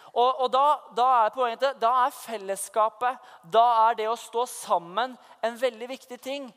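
normal talking voice

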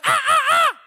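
loud, very high bird squawks. Acted. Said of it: "Ja, enda høyere". (enda høyere, skingrende fugleskrik)

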